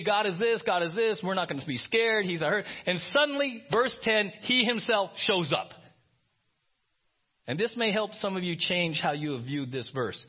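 usual speaking voice

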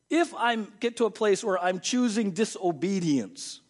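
ordinary talk, nothing marked

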